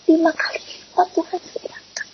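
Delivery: hushed whisper of wonder; whispered, overwhelmed by the blessing; awed whisper. Real, not acted. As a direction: breathe it out in awe. Repeats the miracle in awe.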